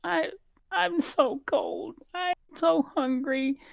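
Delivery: put-on very high, slow, trembling child voice; pitiful little girl. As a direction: whimper it out like a freezing orphan. GM voices shivering orphan girl.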